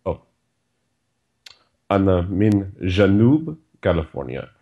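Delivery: ordinary talk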